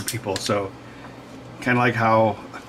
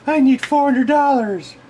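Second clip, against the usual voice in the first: mom's voice; 12 semitones higher, 4 dB louder, slower than usual. Retold or imitated. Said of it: "So my mom's like".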